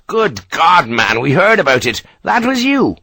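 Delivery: delighted Irish captain, pitch jumping; lightly Irish, thrilled. A narrator's reading. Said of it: "clearly delighted".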